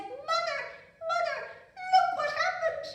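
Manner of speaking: very high, loud frantic calling; panicked princess. Acted. Princess runs to her mother, high frantic calls. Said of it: "came running into the kitchen".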